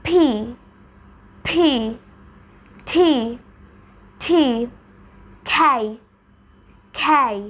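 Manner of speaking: পি টি খেই with a strong puff of air; aspirated পি, টি, খেই, breathy burst. Shown as a demonstration. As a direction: push breath out after each পি, টি, খেই. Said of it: "এখন যদি আমি aspirate করে বলি".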